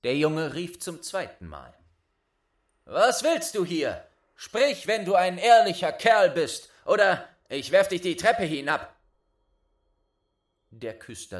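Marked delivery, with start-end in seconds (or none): 2.8-8.9 loud, high angry challenge; threatening shout. Acted. Boy warning the ghost to speak or fall. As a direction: threaten him, full voice.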